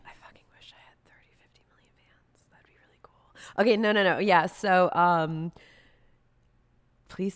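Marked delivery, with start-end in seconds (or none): 0.1-3.3 fast hushed whisper; quick whispered aside. Real, not acted. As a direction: mutter it under your breath as a joke. Whispered self-deprecating aside.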